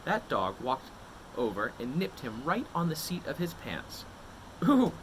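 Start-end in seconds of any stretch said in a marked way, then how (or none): 4.6-4.9 sudden high loud ooh; high loud startled ooh; startled yelp. Acted. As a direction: yelp as the dog nips. Dog nips his pants.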